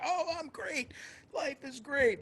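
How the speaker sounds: very high-pitched put-on "doing great"; fake cheerfulness. Broke Bruce lying to the dean, falsely cheerful in a high voice. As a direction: overdo the cheer, obviously lying. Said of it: "lying up his ass".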